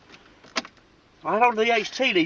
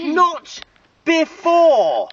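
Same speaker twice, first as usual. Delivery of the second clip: higher, emphatic outburst, word by word; exasperated scolding; 7 semitones higher, 5 dB louder, much slower than usual. Real, not acted. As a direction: scold them hard, punching each word. Scolds after second shock.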